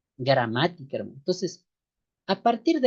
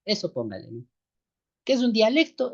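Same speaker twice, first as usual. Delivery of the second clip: usual speaking voice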